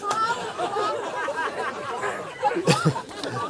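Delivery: real delighted laugh; high burst of laughter. Real, not acted. Teacher laughing at the story's twist. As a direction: laugh at your own joke.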